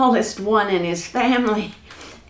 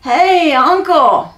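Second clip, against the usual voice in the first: long, high, loud holler; 6 semitones higher, 7 dB louder, slower than usual. Acted. Jack calls across the valley to an uncle, hollering. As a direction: call out far across a valley. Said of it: "Jack hollered".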